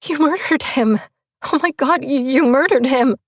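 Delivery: high, choked disbelief; choked, incredulous. A narrator's reading. Narrator confronts Leonard after the killing, high choked disbelief. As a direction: push it out high and strangled, reeling. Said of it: "my choked, incredulous voice".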